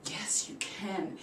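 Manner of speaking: slow, hushed reassurance; soft encouragement. Retold or imitated. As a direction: whisper it to calm his nerves. Teacher encouraging softly.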